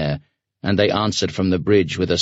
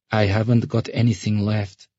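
put-on downcast girl; 3 semitones higher than usual. Sasha repeating herself to the ferryman, downcast. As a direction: repeat it with eyes lowered. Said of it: "she repeated, lowering her eyes".

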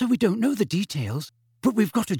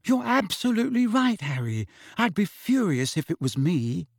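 tearful; 7 semitones higher than usual. A narrator's reading. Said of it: "her eyes now sparkling with tears".

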